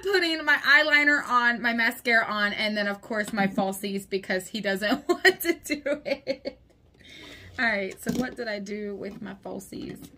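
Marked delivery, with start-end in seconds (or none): none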